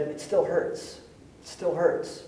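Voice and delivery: soft, nearly whispered hurt; hushed grief. Retold or imitated. Grieving siblings telling the pastor, hushed and soft. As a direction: say it quietly like fresh loss. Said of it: "you know what they said".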